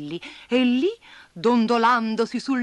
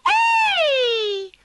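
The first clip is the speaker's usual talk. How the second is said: high, loud little spider shriek; 6 dB louder than usual. A narrator's reading. Woken spider yelling at the ants, high shriek. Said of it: "strillò la ragnetta magica".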